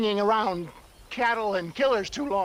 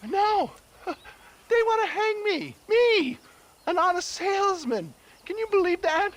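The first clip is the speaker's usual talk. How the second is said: indignant outrage; 9 semitones higher than usual. Acted. Scared man protesting his innocence.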